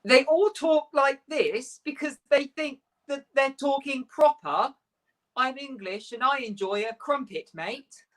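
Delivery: slow, high-pitched mock British accent; an American's hammed-up attempt at British English; American faking British accent. Shown as a demonstration. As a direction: put on an overdone British accent, slowly. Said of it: "impression of an American putting on a British accent".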